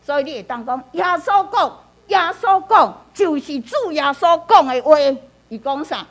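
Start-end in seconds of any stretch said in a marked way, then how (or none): 2.1-5.0 耶稣讲 raised high and loud; emphatic. Shown as a demonstration. Teacher modelling prayer on important words, emphatic 耶稣讲. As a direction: proclaim 主耶稣讲的话 with weight. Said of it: "真重要".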